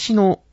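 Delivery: 橋の lifted high and loud to show the bridge word; stressed word. Shown as a demonstration. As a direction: punch 橋の up high. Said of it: "これは川などにかかっている".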